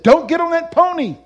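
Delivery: high, loud retold command from heaven; booming warning.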